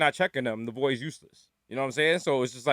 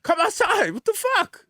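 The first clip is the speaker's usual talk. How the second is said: high-pitched incredulous holler; mock calling out; slower than usual. Smoker on hotel balcony to people inside, exasperated. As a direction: call out in exaggerated disbelief.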